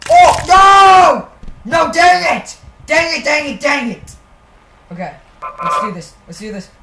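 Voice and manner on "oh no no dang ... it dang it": loud, annoyed "dang it" outburst; frustrated yelling. Real, not acted. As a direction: yell it, really fed up. Falls in Stairs Run.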